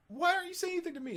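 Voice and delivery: mock kid's high whine; imitating a whiny kid. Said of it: "And then they're like".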